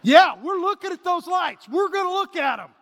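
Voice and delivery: a dad refusing to give in, loud and high; insisting. Retold or imitated. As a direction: double down stubbornly on the lights.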